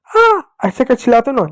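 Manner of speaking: put-on high आ like a hurt child; mock cry of pain. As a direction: pretend yelp of pain. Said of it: "चोट लगती है चिल्लाते हो ना". (चोट लगने पर नकली चीख)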